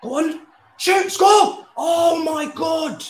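very high, loud yell at the match; high-pitched gamer outburst; real excited shout. Real, not acted. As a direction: yell for the shot, then stretch out the dismay. Shouting at a missed chance in game.

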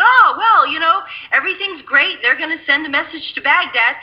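bright, high sugary reassurance; mock nice. Reassuring her CIA handler with mock sweetness. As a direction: play it sweetly innocent to the boss. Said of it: "I didn't tell you to be nice".

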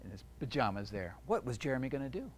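usual speaking voice

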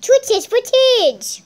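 high, loud shout; loud, high play yell; excited calling out. Pretend. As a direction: call it out loudly, pitched right up.

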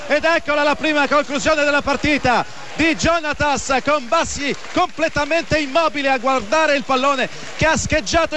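excited rising play-by-play shout; breathless italian goal commentary. Real, not acted. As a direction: call the near miss at full voice. Near miss, live radio commentary.

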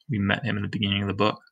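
ordinary delivery

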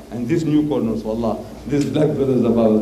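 normal talking voice

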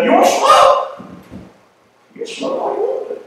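mock-inviting, sliding up high; sarcastic high taunt, slowed down. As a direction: dare them sarcastically. Mocking the would-be sinner.

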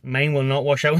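usual speaking voice

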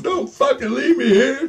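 high, drawn-out mock wail; strained cry of abandonment at contained volume; emotional plea. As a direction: re-enact a desperate plea, slow and high. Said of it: "I'm having all the emotions of".

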